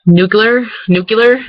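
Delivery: "nuclear" with the ending swapped to -kyuh-ler, questioning; Michigan pronunciation. Shown as a demonstration. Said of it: "I say nuclear".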